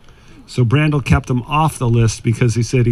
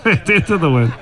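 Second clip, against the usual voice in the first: "Into the wind" repeated through a laugh, a bit higher; 5 semitones higher than usual. Real, not acted. Joking to golfers about playing holes into the wind, laughing. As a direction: echo "Into the wind" as you laugh.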